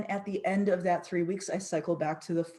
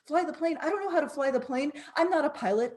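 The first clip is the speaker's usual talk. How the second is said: higher, fast, disbelieving; incredulous panic; 7 semitones higher, faster than usual. Retold or imitated. Passenger told to fly the plane, high and panicky. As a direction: panic at being asked to fly. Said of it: "And you say".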